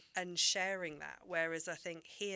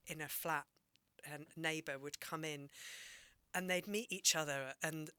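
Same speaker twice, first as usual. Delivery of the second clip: normal talking voice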